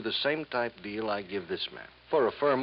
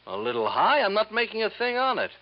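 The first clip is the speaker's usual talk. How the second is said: higher and louder, mock-wounded outrage; indignant protest; 12 semitones higher, 7 dB louder than usual. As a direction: act insulted that anyone calls the price high. Haggling salesman protests price.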